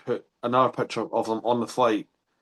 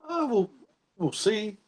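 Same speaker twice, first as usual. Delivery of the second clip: mock high voice; 10 semitones higher, 3 dB quieter, slower than usual. Mock reply to coach.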